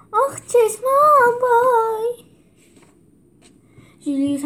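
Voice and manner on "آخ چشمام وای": high loud pained wail; slow loud wail of pain; blinded cry. A narrator's reading. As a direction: cry out as the sun stings. Blinded by sunlight.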